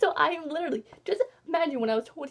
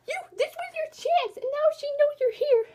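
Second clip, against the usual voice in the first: frustrated scolding; 14 semitones higher, 4 dB louder than usual. Retold or imitated. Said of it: "I was just like".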